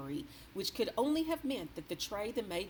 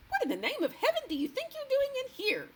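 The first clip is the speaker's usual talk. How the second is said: much higher, loud angry shout; 7 dB louder than usual. A narrator's reading. The maid shouting angrily at Sophie on the sill. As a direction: demand it furiously. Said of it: "she shouted angrily".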